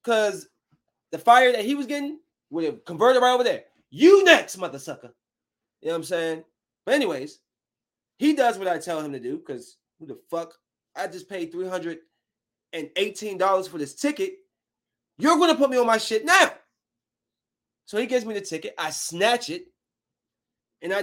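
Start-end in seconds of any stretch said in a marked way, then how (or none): 3.9-5.1 angry threat. Retold or imitated. Acted threat to agent's teammate. 15.2-16.6 loud, high retold ultimatum; angry demand. Acted demand to airline agent.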